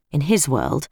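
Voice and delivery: plain reading voice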